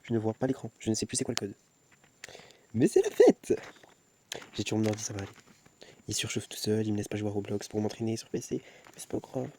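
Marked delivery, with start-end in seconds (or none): 2.7-3.6 very high, loud cheer; sudden high-pitched whoop; party shout. Pretend. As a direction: burst out yelling like a party's started.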